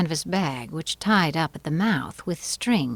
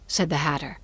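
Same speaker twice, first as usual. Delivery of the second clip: ordinary delivery, nothing marked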